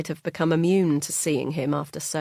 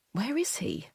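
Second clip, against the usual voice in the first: hushed worry; 5 semitones higher, 6 dB quieter than usual. Acted. Worried inner thought.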